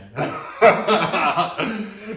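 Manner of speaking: big real burst of laughter; loud laugh. Real, not acted. Laughing loud at his own story.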